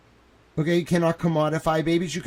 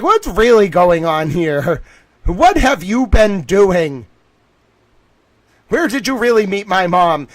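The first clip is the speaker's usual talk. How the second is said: louder, slow, pressing demands; loud interrogation; 3 semitones higher, 9 dB louder than usual. Retold or imitated. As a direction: grill him, every question a push. Grilling donor on phone call.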